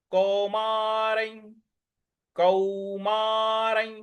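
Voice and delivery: कौमारै drawn out slowly, ending in a glide; slow recitation demo. Shown as a demonstration. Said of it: "इसका उच्चारण हमें य इस प्रकार से लेना है".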